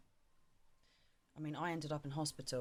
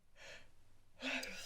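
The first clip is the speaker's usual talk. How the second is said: big yawn; 10 semitones higher, 5 dB quieter than usual. Real, not acted. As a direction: let a real yawn out. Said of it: "I'm about to yawn".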